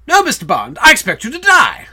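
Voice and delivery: loud, rising movie-villain reply; Goldfinger. Acted. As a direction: throw it out loud, jumping high at the end. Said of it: "Do you expect me to talk?".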